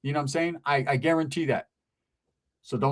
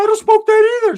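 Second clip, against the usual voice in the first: very high, loud squeaky friend voice; Ricky's squeaky voice; 11 dB louder than usual. Retold or imitated. Ricky explaining himself to the chick, very high and loud. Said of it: "He was like".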